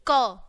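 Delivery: क punched out loud and short; a crisp hard क for the children to copy; letter sound k. Shown as a demonstration. Teacher repeating the k sound loudly for pupils. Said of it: "कौन सी sound निकलेगी".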